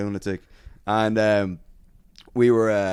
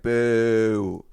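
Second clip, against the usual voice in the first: louder, raised, drawn-out boo; crowd booing; 3 semitones higher, 6 dB louder than usual. Retold or imitated. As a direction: imitate a jeering crowd. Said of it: "the crowd started booing them".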